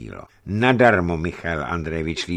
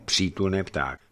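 ordinary delivery, nothing marked

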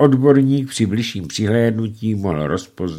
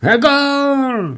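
much higher, joyous yelp; joyful shout; 4 dB louder than usual. A narrator's reading. Chauffeur cheers the wife's miss, joyful shout. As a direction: cheer it out gleefully. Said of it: "vykřikl Antonín radostně".

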